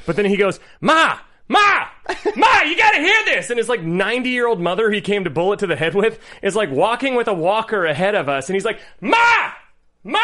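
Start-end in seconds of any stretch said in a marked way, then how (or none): 0.8-3.5 mock yell, higher and louder; yelling for mom. Fan in the theater calls his old mother, mock yell. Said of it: "how aggressively he yelled for his mom". 9.0-10.2 high mock shout; yelling for mom. Fan shouts for his mother to come over, mock shout. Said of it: "how aggressively he yelled for his mom".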